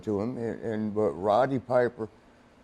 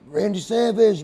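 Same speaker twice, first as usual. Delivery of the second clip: star-struck; 8 semitones higher, 3 dB louder than usual. Retold or imitated. Police star-struck at seeing wrestler.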